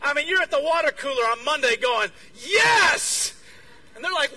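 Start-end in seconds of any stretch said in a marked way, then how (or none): 2.3-3.3 loud, high, drawn-out cheer; acted burst of triumph; exultant shout. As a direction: celebrate at full voice. Imagined elation at water cooler.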